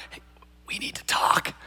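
urgent whisper as Peter. Retold or imitated. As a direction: pull him aside and whisper. Peter pulls Jesus aside.

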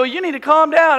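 loud, high order from a father; retold heated scolding; arguing dad. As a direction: imitate a dad mid-fight. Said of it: "would get in a fight".

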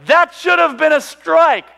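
slow, high, loud protest; retold bowler's tantrum; mad kid. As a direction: shout each word in outrage. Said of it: "And I'm so mad".

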